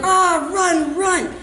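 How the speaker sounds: high, hammed-up cry of panic; panicked yell. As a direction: yell to flee from the cop. Acted flight from the cop.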